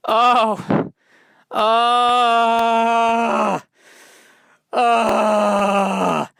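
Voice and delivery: high, loud anguished squawks; imitating a bird's loud high cries; bird cry. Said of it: "it was a bird going".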